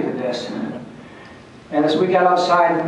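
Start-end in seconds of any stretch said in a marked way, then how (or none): none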